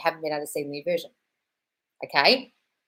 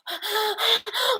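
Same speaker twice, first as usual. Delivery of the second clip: mock gasp. Mocking insecure partner.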